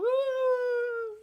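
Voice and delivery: high, loud fake sob of "Ah"; sarcastic imitation of crying; mock crying wail. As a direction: wail it like a hammed-up crybaby. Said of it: "He's sitting there crying".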